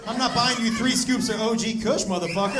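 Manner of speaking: loud, fast mock outrage at his son; hammed-up scolding of a freeloading kid; mock angry rant. Dad rants at son.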